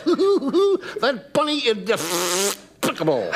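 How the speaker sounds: high, slow, lisping crazy duck; a jealous, spluttering little duck; Daffy Duck voice. Acted. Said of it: "and he says".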